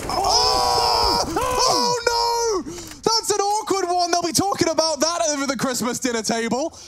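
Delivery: high, loud shocked commentary; excited shout. Real, not acted. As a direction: yell it out in disbelief. Shock at a missed play.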